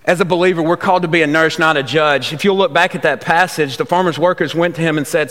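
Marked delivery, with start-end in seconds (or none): none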